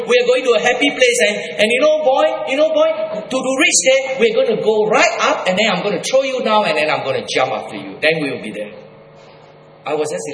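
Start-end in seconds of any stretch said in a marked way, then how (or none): 0.1-8.0 a hint of the mother's eerie cheer, higher. Mother telling her son of a happy place, lightly voiced. Voicing his disturbed mother.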